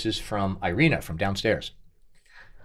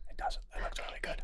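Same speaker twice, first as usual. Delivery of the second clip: very soft whispered aside; 17 dB quieter than usual. Real, not acted. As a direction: whisper it so the audience won't hear. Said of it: "So much for whispering".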